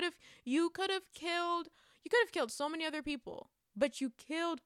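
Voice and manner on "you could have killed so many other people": ordinary delivery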